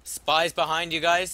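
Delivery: urgent warning shout. Real, not acted.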